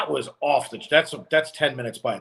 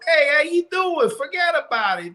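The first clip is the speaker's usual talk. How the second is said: put-on New York accent; 11 semitones higher than usual. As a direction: play up a thick New York Italian greeting. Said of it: "Southern drawls... going".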